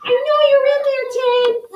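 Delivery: high-pitched angry calling through the door; angry mayor. Acted.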